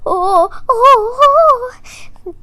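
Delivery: high, loud scared oh-oh-oh; frightened howl. Acted. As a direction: howl out in fear. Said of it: "Raccoon howled".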